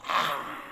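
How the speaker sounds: mock wail of a child; a drawn-out pretend bawl; imitated crying. Child crying over the broken fishbowl, imitated wailing. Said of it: "이 아이가 막 웁니다".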